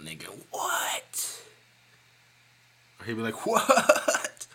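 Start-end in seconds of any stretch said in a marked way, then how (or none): 0.5-1.4 breathless high whispered What. Retold or imitated. Friend stunned by the clip, breathless whisper. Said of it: "Wesley be mind blown". 3.5-4.5 high breathy chuckled What. Retold or imitated. Friend laughing in disbelief, chuckled What. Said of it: "He kinda chuckle it out".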